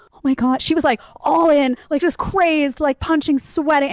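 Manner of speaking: high, breathless retelling; shocked retelling. Retold or imitated. Recalling watching her friend lunge at the guy, high and shocked.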